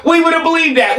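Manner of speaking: laughing through the words; real mocking laughter. Real, not acted.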